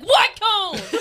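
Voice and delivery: high, loud, incredulous outburst; exasperated mock yell. Retelling the argument about cones, exasperated yell.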